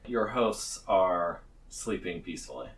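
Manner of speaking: ordinary talk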